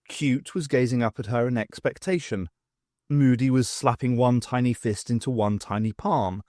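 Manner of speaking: ordinary delivery, nothing marked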